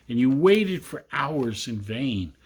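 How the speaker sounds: usual speaking voice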